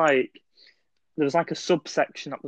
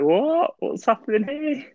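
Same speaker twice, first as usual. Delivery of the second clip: sharp high startled what; retold surprise; 10 semitones higher, slower than usual. Dreamer hears the cartel news, startled and high. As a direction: shoot the pitch up in shock. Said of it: "And then I'm like".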